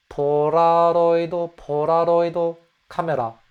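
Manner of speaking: 폴라로이드 카메라 with a quick soft L-D flap; Japanese Polaroid. Shown as a demonstration. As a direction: rattle off 폴라로이드 카메라, flapped L. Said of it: "a soft L sound with a hint of a soft D".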